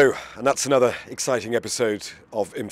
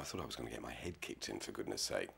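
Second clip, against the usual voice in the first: shaken; 10 semitones lower, 16 dB quieter than usual. Acted. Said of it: "I'm shaking there".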